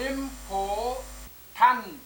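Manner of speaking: slow important split into इम, पोर, tent; syllable by syllable. Shown as a demonstration. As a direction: draw out each part of important with gaps. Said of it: "यहाँ पर एक syllable ये है".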